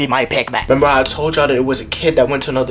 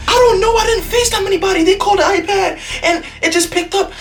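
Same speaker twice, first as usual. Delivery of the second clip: very high frantic excuses; student panicking under questioning, very high; panicked squeal. Retold or imitated. Student answering principal.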